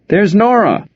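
high excited shout; excited call. Acted. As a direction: call out her name. Someone in crowd calls out.

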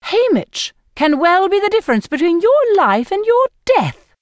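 high, prim, scolding escort voice; fussy Effie. A narrator's reading. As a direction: lecture them, rising in indignation. Effie's voice held in narration.

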